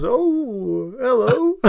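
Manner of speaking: high-pitched startled mock hello; Robert. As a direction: imitate a taken-aback guest picking up. Imitating guest answering the call.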